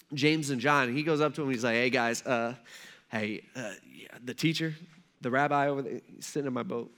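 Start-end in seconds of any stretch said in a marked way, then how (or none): 1.8-6.9 low, halting, sheepish approach; awkward. Acted. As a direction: break the news reluctantly, trailing off softer. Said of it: "Yeah, awkward".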